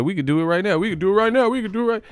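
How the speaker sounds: mock fighter calling out a rival, climbing in pitch; rising challenge. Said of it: "He said".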